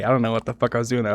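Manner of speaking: usual speaking voice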